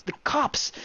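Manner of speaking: high-pitched cheer. A narrator's reading. Traveler spots police rolling up, cheering. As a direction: cry out in high-pitched relief. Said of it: "I cheered".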